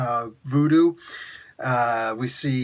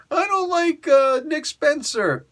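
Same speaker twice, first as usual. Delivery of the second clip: a high, slowed sneering imitation; mock the haters; mocking whiny critic; 12 semitones higher, slower than usual. Reviewer mimicking fans who dislike the writer, whiny mockery.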